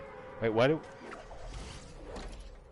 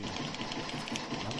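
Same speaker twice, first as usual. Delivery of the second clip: normal talking voice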